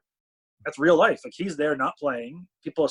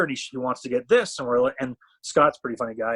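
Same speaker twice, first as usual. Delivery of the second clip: usual speaking voice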